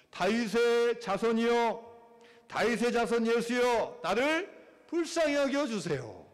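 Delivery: slow, high, drawn-out shout for mercy; loud crying out. Acted. Blind Bartimaeus calling to passing Jesus, crying out loudly. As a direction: call out pleadingly, stretching each phrase. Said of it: "큰 소리로 외치는 거예요".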